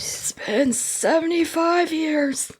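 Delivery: slow, high, whispered melodrama; comically drawn-out breath of a voice; hammed-up whispery voice. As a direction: stretch it out in a mock-weary whisper. Joking exaggeration of the long wait.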